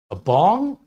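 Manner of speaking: high, loud, incredulous question; sharply rising, puzzled query; baffled outsider. Acted. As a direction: mishear it and ask in disbelief. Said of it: "nobody knows what the, what you're talking about".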